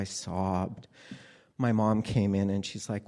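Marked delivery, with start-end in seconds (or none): none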